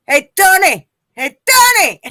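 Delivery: calling out. Acted. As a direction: shout for Tony to hear. Calling out to absent Tony.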